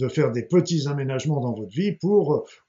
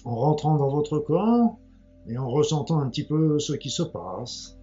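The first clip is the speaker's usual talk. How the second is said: guided meditation; 5 semitones lower, 9 dB quieter than usual. Acted. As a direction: ease them gently into calm.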